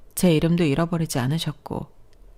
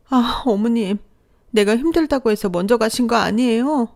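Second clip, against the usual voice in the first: tearful wail; 6 semitones higher, 3 dB louder than usual. Acted. Grieving daughter-in-law at mother's death.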